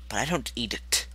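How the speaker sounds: ordinary talk, nothing marked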